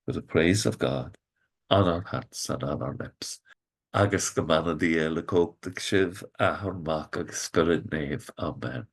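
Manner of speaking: quiet, low bedtime prayer; soft closing blessing. Acted. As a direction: fade into a whispered blessing. Evening blessing, softening.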